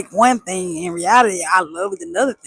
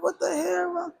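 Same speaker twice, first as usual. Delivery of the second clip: incredulous; 11 semitones higher, 8 dB quieter than usual. Retold or imitated. Annoyed at brother.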